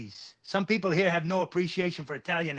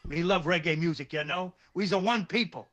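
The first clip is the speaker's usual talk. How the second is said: put-on Jamaican Rasta accent; Rastafari patois. Asked to talk Rastafari, mock Rasta patois. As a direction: speak as an easygoing Rasta in patois. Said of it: "can you talk in Rastafari".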